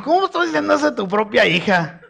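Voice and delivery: wife's shocked reply, laughing through it; laughing mock outrage. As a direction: play her scandalized, half laughing. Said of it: "Ella me dijo". (esposa escandalizada entre risas)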